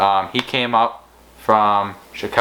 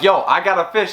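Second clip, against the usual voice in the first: excited retelling; 8 semitones higher than usual. Retold or imitated. Retelling his excited call to friend.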